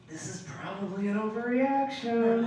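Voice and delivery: drawn-in, hesitant realization; self-doubting aside. Retold or imitated. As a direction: pull back and wonder quietly to yourself. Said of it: "you're like".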